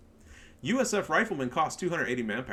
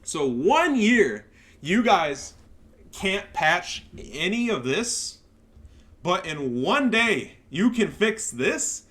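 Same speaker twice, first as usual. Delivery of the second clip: loud, slow, higher accusing words; indignant rant; 5 semitones higher, 5 dB louder, much slower than usual. Real, not acted. Accusing the Relic devs, slow indignant rant. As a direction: spell out the hypocrisy word by word.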